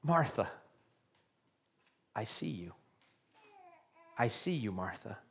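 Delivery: very soft, low and tender; compassionate. Retold or imitated. Preacher voicing Jesus tenderly seeing Martha, very soft. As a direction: hush down, full of compassion. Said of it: "with compassion in his voice".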